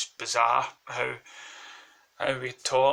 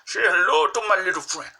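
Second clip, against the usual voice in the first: hammed-up movie gangster, pitched up; Al Pacino; 6 semitones higher, 4 dB louder than usual. Shown as a demonstration. Vlogger showing voices he'd love to do, hammed-up Pacino impression. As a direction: throw out a higher, mock gangster catchphrase. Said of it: "Oh, Al Pacino".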